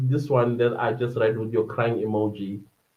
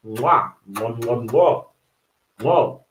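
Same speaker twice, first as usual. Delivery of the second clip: air kisses; 3 semitones lower, slower than usual. Real, not acted. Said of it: "I did that to my main channel".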